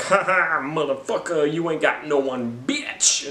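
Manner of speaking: high, gloating jeer; mock taunting Gamora. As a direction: laugh in his face, hammed up. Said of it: "Gamora's like".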